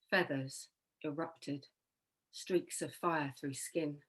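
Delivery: poetry reading. Acted. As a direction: read it softly, line by line. Reads own poem aloud.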